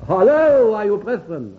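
high, loud greeting that drops in volume; acted eager hello; excited hailing. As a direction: imitate a soldier calling out to prisoners. Voices the corporal hailing the prisoners.

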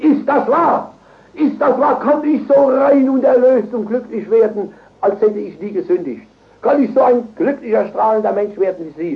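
high, insistent disbelief; urgent amazed questioning. Retold or imitated. Seekers grip the preacher's hand asking if it's true, urgent and amazed. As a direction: ask it pleadingly, voice lifted high.

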